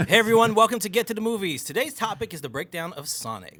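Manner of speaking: a joking announcer welcome, half laughing; mock show intro. Mock podcast intro while laughing.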